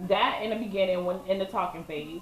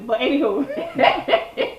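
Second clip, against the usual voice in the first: louder, higher, slow laughing brush-off; 6 semitones higher, 5 dB louder, much slower than usual. Real, not acted. Moving on after partner's teasing, laughing. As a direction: drag it out through a laugh.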